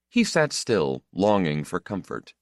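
plain reading voice

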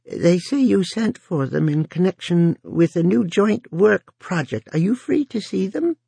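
put-on high, rasping elderly voice; quaking old woman. A narrator's reading. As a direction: rasp it out like a frail old woman. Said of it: "quaking elderly voice of Missus Frick rasped".

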